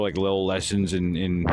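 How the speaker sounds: usual speaking voice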